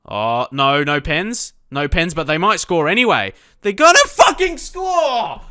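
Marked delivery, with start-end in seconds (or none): 3.6-5.4 shouted high and loud, slowed; panicked yell. Real, not acted. Gamer watching the rival break through, panicked yell. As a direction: dread the goal coming.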